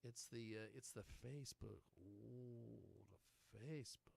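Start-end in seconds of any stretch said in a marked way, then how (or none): 2.0-4.1 whispered, softer mock ooh; mock hushed awe. Host teasing about the Facebook, in a mock-awed whisper. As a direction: whisper it as if hugely impressed.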